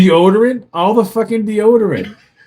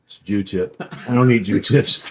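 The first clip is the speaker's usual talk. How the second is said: low mock kid voice; 9 semitones lower, 4 dB quieter than usual. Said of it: "He goes".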